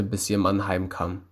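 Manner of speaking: plain reading voice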